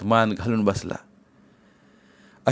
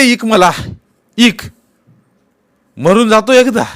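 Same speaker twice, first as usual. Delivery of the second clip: despairing rage; 11 semitones higher, 9 dB louder than usual. Acted. Patil despairing to his mother and wife, shouting. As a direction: rage at losing face in the village.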